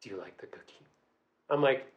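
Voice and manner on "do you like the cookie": hushed, low creepy whisper; dark creepy date. Retold or imitated. Storyteller imitating her date turned dark, creepy whisper. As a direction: imitate a sinister lover, barely audible. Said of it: "snake eyes, like, squinty".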